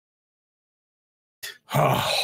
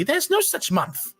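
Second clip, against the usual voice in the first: high scolding voice; 12 semitones higher, slower than usual. Acted.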